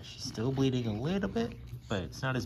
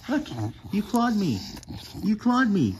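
higher, louder playful reproach; mock scolding; 9 semitones higher, 5 dB louder than usual. Real, not acted. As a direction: tease the dog for the scratch.

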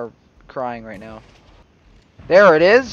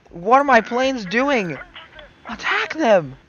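exasperated shout; 8 semitones higher, 4 dB louder, slower than usual. Real, not acted. Planes fail to attack.

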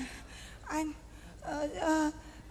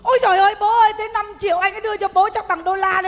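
mock lament; 3 semitones higher, 7 dB louder than usual. Mock lament over money envelope.

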